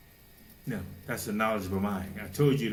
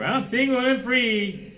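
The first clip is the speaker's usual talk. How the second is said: high, loud, slow gloating; mock carefree boast; 14 semitones higher, 9 dB louder, slower than usual. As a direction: parody a giddy newly single person. Mocking carefree divorcee.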